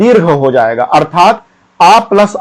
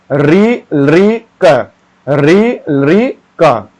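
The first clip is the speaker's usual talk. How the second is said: drawn-out syllables for students to repeat; slow recitation; much slower than usual. Shown as a demonstration. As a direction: sound each syllable very slowly. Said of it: "पढ़िएगा आप मेरे साथ साथ". (धीरे धीरे उच्चारण)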